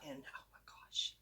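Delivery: soft whispered oh my gosh. Real, not acted. Telling viewers about the loaded tea, a whispered oh my gosh. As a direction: breathe it out in a whisper.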